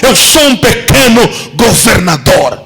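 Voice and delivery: high, shouted declaration; passionate proclamation. Acted. Nehemiah proclaiming to the king, high shout. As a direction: preach it as Nehemiah, full voice.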